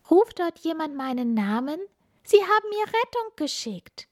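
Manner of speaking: put-on high, wondering child voice; a tiny amazed voice going way up; little star child. Funkila hearing her name, high and amazed.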